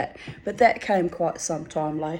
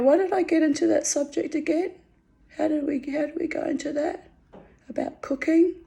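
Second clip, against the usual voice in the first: puzzled self-question; 9 semitones higher than usual. Real, not acted. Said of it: "losing her thread".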